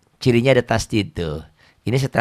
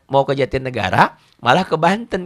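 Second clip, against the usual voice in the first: ordinary delivery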